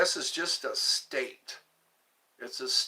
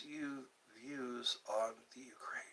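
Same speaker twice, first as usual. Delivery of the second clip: hushed unvoiced question; whispering; 4 semitones lower, 15 dB quieter than usual. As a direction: whisper it like a secret.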